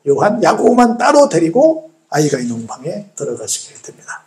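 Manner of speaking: ordinary delivery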